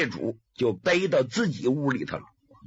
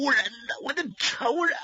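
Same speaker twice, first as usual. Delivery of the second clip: high, strained cry about his enemy; pained, bitter outcry; 8 semitones higher than usual. Acted. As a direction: spit out the word enemy through pain. Wounded man names his attacker.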